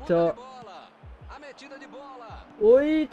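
ordinary talk

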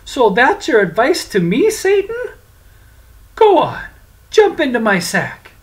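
put-on high, loud, defiant young voice; spring the trap on him; triumphant prince. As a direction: prince triumphantly orders Satan into the sack. Francis turns on Satan.

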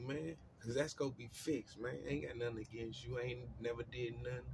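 ordinary delivery

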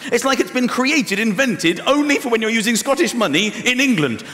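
ordinary talk

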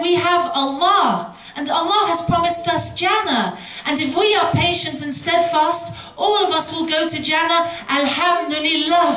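calm faith. Retold or imitated. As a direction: lift the voice, serene and sure. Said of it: "She said".

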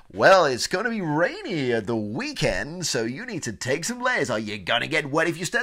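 mock-cheerful, sing-song forecast; happy weather presenter. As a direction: deliver bad weather far too happily. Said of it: "They're happy about it".

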